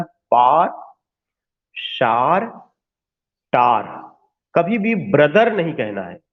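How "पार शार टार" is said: पार, शार, टार each a single drawn vowel; one syllable. Shown as a demonstration. Teacher listing correct one-syllable forms. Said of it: "जो pronunciation कहना है".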